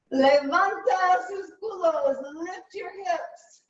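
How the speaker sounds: high, slow playful sing-song; teasing, drawn-out cue with sass; sassy. Real, not acted. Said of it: "I'm very sassy this morning".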